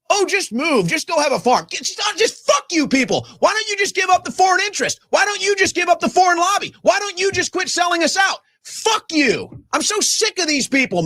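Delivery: high, shouted fury; angry rant. Real, not acted. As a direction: spit it out at them, pitch way up.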